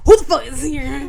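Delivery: mock startled yell. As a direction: play up the startled reaction. Acting startled man in closet.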